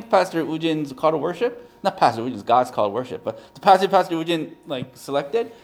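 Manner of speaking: ordinary talk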